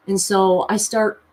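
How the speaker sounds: usual speaking voice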